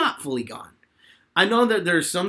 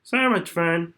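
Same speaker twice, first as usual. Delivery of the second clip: Junkrat voice; slower than usual. Shown as a demonstration. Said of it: "my favorite voice to do".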